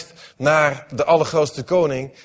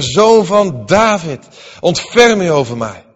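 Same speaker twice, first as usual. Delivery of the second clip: higher and louder, zoon van David drawn out; crying out louder; 3 semitones higher, 7 dB louder than usual. A narrator's reading. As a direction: call again, even more desperately. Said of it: "Maar hij riep des te meer". (nog luider en hoger roepen)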